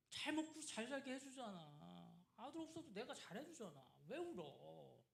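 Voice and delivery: exasperated, dismissive husband, pitch jumping high; annoyed sigh, then snapping at a crying wife; irritated husband. Retold or imitated. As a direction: scold her like a fed-up husband. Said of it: "이런 느낌인 거예요".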